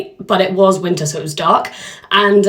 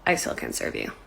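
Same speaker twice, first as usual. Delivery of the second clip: bartender refusing; 12 dB quieter than usual. Acted. Acted bartender refusing again.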